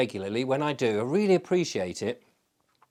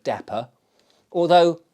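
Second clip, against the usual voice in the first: ordinary talk, nothing marked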